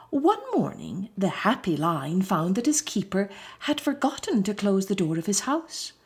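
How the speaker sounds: usual speaking voice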